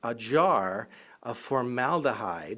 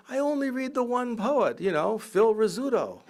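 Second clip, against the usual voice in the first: high mock Yogi voice, laughing at the end; a lightly voiced, higher Yogi, deadpan innocent; higher playful Yogi impression; imitating Yogi Berra; 10 semitones higher, 4 dB louder than usual. Retold Yogi punchline.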